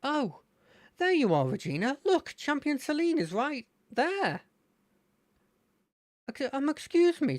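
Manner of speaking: put-on excited kid spotting his hero; Terry's voice. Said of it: "I'm gonna try that with Terry".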